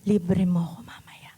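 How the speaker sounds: breathy whisper to the person beside you; playful whispered request. Shown as a demonstration. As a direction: lean in and whisper it. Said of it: "Bulungan mo yung katabi mo".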